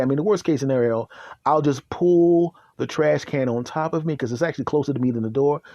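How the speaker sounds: ordinary talk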